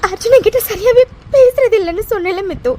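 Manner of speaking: sobbing words out to a friend; crying to her friend about Arjun. Acted. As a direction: let the tears break each phrase. Holds crying throughout.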